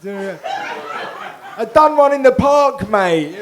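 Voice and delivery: loud mocking impression of the mosaic artist; mock Luke's voice. Comedian mimics audience mosaic artist's unlikely voice, loud mocking impression. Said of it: "Your voice isn't the voice of a mosaic artist".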